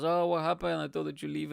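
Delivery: sing-song jibe at the returners; mocking. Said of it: "everybody's making fun of us".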